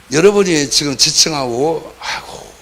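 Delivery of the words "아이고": whispered sigh, then a judging scoff; mock a pious person clicking their tongue; lightly voiced scornful churchgoer; self-righteous tut. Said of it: "그렇게 생각하는 그 압살롬들의".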